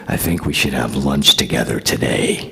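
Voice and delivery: low, menacing imitation of a co-star; intimidating man. Intimidating co-star finally invites the young actor to lunch, low imitation. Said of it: "probably the most intimidating man".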